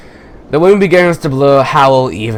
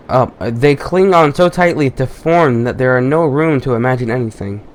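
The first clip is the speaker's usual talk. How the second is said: normal talking voice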